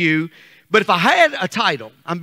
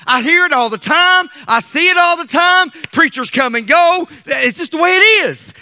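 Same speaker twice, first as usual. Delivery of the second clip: building, higher and louder preacher's cadence; preaching; 7 semitones higher, 5 dB louder than usual. Acted. Pastor preaching seasons of life to the church, rising fervour. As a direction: climb into full preaching fervour.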